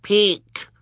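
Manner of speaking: put-on very high little toddler squeak; baby's cry. A narrator's reading. Baby Sunny urging the crowd to look, high-pitched cry. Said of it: "Sunny cried".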